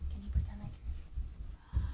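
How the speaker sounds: unvoiced sleepy whisper; hushed bedtime prompt. Shown as a demonstration.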